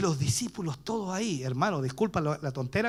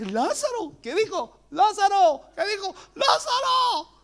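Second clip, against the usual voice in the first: llamado agudo repetido como eco; Lázaro rebotando en la tumba; eco imitado; 13 semitones higher, slower than usual. Retold or imitated. El eco ahí. (eco agudo del llamado)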